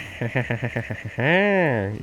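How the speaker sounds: Waluigi laugh. Acted. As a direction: cackle again to tease. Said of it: "the Waluigi laugh".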